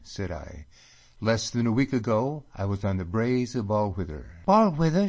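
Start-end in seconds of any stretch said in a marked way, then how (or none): 4.4-5.1 put-on girl cries out at her homeland's name; excited cry. A narrator's reading. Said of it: "she cries".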